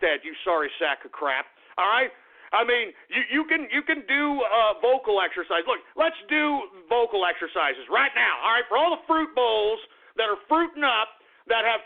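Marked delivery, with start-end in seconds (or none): none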